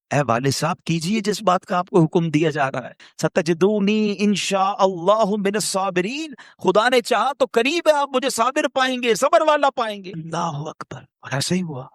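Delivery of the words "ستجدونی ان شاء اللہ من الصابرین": slow chanted Arabic verse; Quranic recitation. Acted. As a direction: intone the verse slowly and reverently. (آہستہ تلاوت کے انداز میں)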